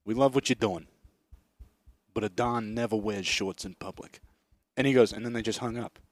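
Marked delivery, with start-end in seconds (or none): 0.0-4.2 low, softer, measured phone voice; quiet, low word of advice from a mobster; mob caller. Retold or imitated. Mysterious caller advises the actor at night, low mobster voice. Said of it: "he heard a voice that goes".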